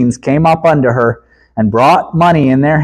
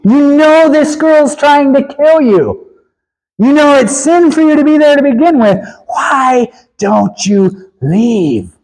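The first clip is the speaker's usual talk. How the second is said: impassioned plea; 10 semitones higher than usual. Real, not acted. Preacher pleads with Samson.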